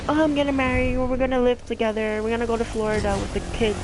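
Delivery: sing-song her promises with a hint of mockery; gushing mom imitation. Daughter lightly mimics mom's gushing promises to her boyfriend. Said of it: "she's like".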